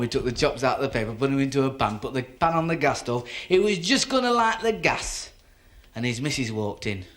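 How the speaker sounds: ordinary talk, nothing marked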